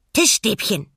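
bright, loud little penguin cry; excited penguin. A narrator's reading. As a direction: chirp it out proudly. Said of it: "Pinguin wiederholte".

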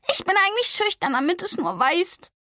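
high, sharp angry retort; snapping at him. A narrator's reading. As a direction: bite back at him. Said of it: "fuhr sie ihn an".

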